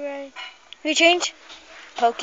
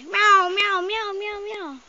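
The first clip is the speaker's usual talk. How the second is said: high mewing, fading away; high-pitched run of kitten cries; kitten meows; 8 semitones higher, slower than usual. Acted. Imitates the kittens crying.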